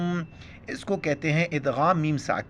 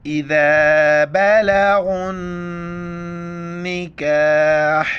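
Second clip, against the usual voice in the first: slow, drawn-out melodic tilawat; Quran recitation; 3 semitones higher, 6 dB louder, much slower than usual. Acted. Teacher reciting the verse for students, slow melodic recitation. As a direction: chant each word long and ringing.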